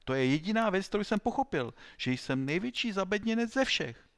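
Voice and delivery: protesting, high and loud; Alfred. A narrator's reading. Pupil insisting he is the biggest dunce, protesting. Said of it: "protestoval Alfred".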